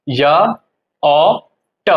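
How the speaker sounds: "य ओ ट" in three separate sounds with long pauses; sounded out. Shown as a demonstration. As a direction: break German J into "य", "ओ", "ट". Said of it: "इसको बोलते हैं यॉट". ("य ओ ट" अलग-अलग, रुक-रुक कर)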